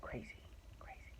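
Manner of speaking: whispered echo. Real, not acted. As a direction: say it again under breath. Whispered repeat of crazy.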